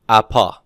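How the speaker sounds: pronounced apa, a plain open vowel start; Greek word apa. Shown as a demonstration. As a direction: say apa with no h sound. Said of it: "So how would you pronounce that?".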